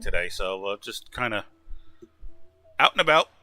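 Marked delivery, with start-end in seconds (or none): 2.8-3.3 high, loud Canadian lilt; unintended Canadian twang, called out loud; Canadian-sounding. Real, not acted. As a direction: sound a touch Canadian, pitched up and louder. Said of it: "You sounded Canadian there".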